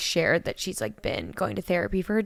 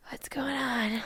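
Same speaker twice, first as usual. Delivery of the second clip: soft bewildered whisper; a dazed whisper to herself; whispered inner voice; 10 dB quieter, slower than usual. Retold or imitated. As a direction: whisper it, lost and confused. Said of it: "I'm just like".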